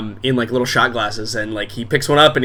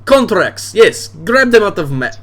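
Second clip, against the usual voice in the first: boisterous bar owner; 8 semitones higher than usual. Acted. Drunk dad welcoming daughter's friends to his bar, booming. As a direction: play the drunk dad greeting guests expansively.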